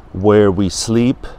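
ordinary delivery

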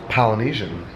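ordinary talk

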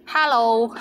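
hello with a Cantonese accent. Shown as a demonstration. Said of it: "or with the Cantonese accent".

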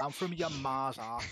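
loud, slow mocking jab; slow it down and shout the insult; cheeky loud retort.

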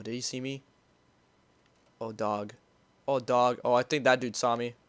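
normal talking voice

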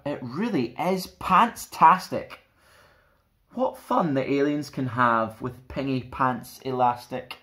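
ordinary delivery

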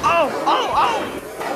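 high yelping oh oh oh; van crying out high; startled cry. Acted. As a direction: squeal as you get hit. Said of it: "cried the van".

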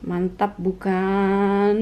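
slow teasing. Real, not acted.

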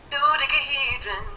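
high, slow drawn-out dodecahedron. Retold or imitated. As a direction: stretch the word out like a pop star. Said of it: "sing it for us one more time".

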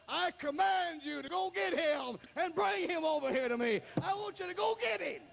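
high, commanding pulpit cry; full-force preaching. Acted. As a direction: issue it as a ringing command.

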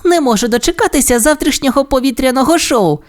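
very high cartoon pup voice; eager pup pilot. A narrator's reading. Sky looks forward to tomorrow's show, high eager pup voice. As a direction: bubble with anticipation, high-pitched.

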